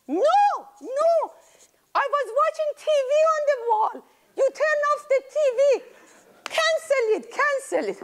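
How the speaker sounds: put-on very high, fast protesting child; upset child. Great-grandchild upset great-grandma turned off the TV, high and fast.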